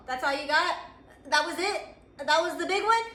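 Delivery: playful swagger toward a defeated obstacle; cocky taunt. Acted. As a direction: shrug it off and taunt the challenge. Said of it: "you're just like".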